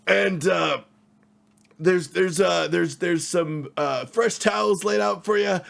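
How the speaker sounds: high, loud, eager innkeeper voice; overly friendly desk clerk, straining upward; cheery hotel clerk. Acted. GM plays Teddy the clerk.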